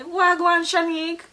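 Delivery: high, lively patois hello; high friendly greeting. Shown as a demonstration. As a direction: call out to Shanique. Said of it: "Billy come up to me and say".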